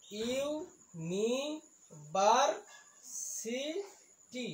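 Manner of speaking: "University" in five long, separate beats; syllable by syllable. Shown as a demonstration. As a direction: sound out "university" very slowly. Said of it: "ইয়াত পাঁচটা চিলেবুল আছে". ("University" পাঁচটা ভাগত ভাঙি লাহে লাহে)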